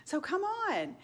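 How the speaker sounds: high, eager come-on-over; inviting call. Pretend. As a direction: call her over happily. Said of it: "I was gonna say".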